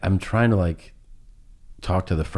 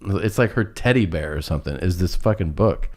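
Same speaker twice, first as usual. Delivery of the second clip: ordinary talk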